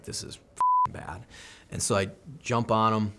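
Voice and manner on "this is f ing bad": inner thought. Retold or imitated. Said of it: "I'm looking around like".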